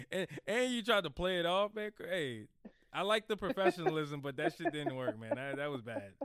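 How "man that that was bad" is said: ordinary delivery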